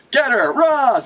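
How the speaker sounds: high, lightly shouted order to attack; half-hearted yell urging a comrade on; mock battle cry. Voicing a fighter's battle cry.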